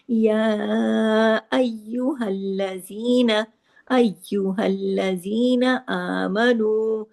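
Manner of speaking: अय्युहल्लज़ीना with the doubled य and ल pressed together, long madds; slow recitation. Shown as a demonstration. Teacher reciting या अय्युहल्लज़ीना slowly, doubled letters joined firmly. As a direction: join अय्युहल्लज़ीना firmly and stretch its vowels. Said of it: "सख्ती से जोड़ना है".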